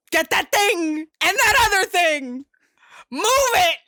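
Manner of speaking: hammed-up flustered boss; mock panic. Panicking villain barking orders.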